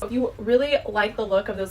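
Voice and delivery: ordinary talk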